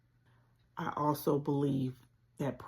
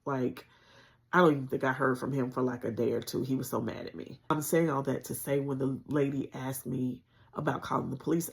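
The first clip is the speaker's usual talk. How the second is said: normal talking voice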